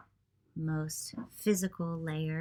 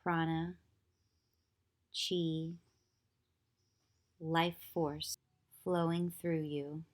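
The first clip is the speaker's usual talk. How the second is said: calm, even meditation voice with long pauses; unhurried yoga nidra guidance, leaving long silences; guided meditation; 3 dB quieter than usual. Acted. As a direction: guide them gently into stillness, phrase by phrase.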